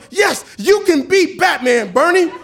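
loud, high, slow joyful yes; excited shout. Retold or imitated. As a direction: cheer the boy on with full delight. Excited reply to child.

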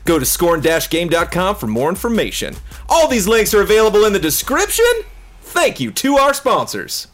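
hammy Southern cowboy ad read. Acted. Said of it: "Why that voice?".

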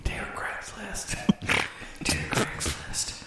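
slow breathy whisper. Acted. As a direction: whisper slowly like a sleazy ad. Mock sexy Craigslist ad.

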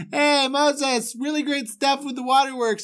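high, whiny, faux-polite complaint; a complaining traveller nagging Moses, high and whiny; grousing Israelite. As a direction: needle the leader with fake cheer. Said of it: "people just started grousing".

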